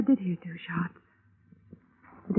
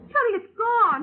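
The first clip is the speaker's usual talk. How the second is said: sudden high alarmed cry; alarmed surprise; 13 semitones higher, 3 dB louder, slower than usual. Acted. Widow finding her gun gone, telling the investigator, alarmed. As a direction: gasp it out as the drawer turns up empty.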